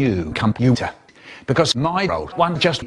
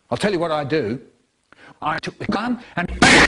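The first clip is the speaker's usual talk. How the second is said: ordinary talk, nothing marked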